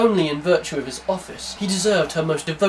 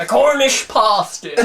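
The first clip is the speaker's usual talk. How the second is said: "pasty" said louder and higher in a mock Cornish accent; 8 semitones higher, 7 dB louder than usual. Shown as a demonstration. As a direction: hit "Cornish pasty" hard, Cornish style. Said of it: "With the Cornish accent".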